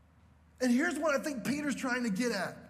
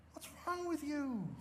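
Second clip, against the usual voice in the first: soft, bewildered dad voice; a hint of a confused father; puzzled dad; 3 semitones higher, 8 dB quieter than usual. Dad asking his teary son, softly puzzled. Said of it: "my dad's going".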